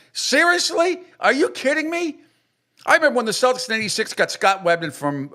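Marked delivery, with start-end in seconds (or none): 0.1-2.2 much higher, sharp disbelief; can't believe what he's hearing; incredulous. Real, not acted. Disbelief at radio host.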